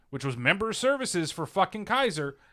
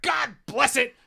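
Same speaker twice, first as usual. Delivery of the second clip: higher mock exclamation from a viral video; imitated video outburst; 7 semitones higher, 4 dB louder, slower than usual. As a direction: cry out like the frustrated tollbooth guy. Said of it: "that's where that sound".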